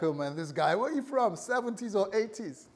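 a hint of a smirking youngster; mocking young listener. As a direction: poke fun at an old-fashioned word. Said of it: "Honey might be like".

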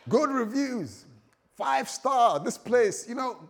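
high, loud, punchy acclaim; excited rave. Acted. Preacher hyping the promised place's reviews, excited. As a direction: rave about it like a glowing review. Acting glowing reviews of the destination.

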